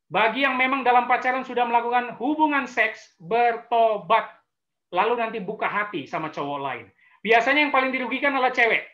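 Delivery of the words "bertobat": "bertobat" stretched very slowly, each syllable weighed; drawn-out command. Shown as a demonstration. Pastor urges sinning couples to repent, very slowly. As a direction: lean on "bertobat" like a final appeal.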